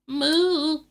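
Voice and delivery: high, loud, drawn-out moo; long high cartoon cow moo. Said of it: "that's my new accent".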